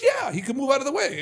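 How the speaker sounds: high, loud eager insisting; insistent reply. Retold or imitated. Telling brother it's real, high eager insistence. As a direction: swear it's true, pitch way up.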